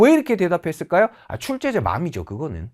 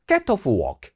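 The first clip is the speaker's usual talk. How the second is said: "get off work" in British English, hard crisp t; British pronunciation; 3 semitones lower, much slower than usual. Shown as a demonstration. Teacher contrasts it with American, harder British accent. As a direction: stiffer British "get off work", each consonant firm. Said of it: "영국 발음으로 조금 딱딱해져요".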